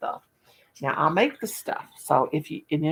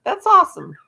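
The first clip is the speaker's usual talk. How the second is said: louder and higher, reading it out with delight; excited reading; 10 semitones higher, 9 dB louder than usual. Retold or imitated. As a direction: belt it out like great news. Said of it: "Spring says".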